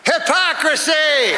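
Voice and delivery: high, slow, drawn-out cry of hypocrisy; a hammed-up shocked yell; mock outraged cry. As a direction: wail it like a scandalized accuser.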